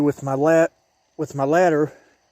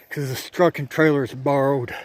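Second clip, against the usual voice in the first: normal talking voice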